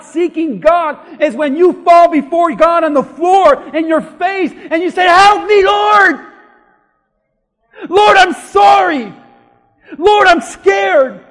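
high, loud preacher's cry to God; full-force preaching. Acted. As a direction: cry out a desperate prayer from the pulpit.

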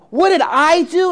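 put-on indignant child demanding an answer; girl's outraged cry. A narrator's reading. Girl accusing the gardener back, outraged shout.

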